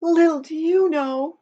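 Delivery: a sad cat moaning her woes. Acted. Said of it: "moaned the cat".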